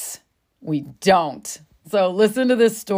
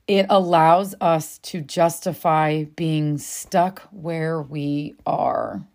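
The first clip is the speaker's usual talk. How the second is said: slow and weighty; 4 semitones lower, slower than usual. Shown as a demonstration. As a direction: let each word drop slowly. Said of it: "Let that land a second".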